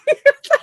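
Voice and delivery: shrieking laugh; high laugh. Real, not acted. Laugh after telling story.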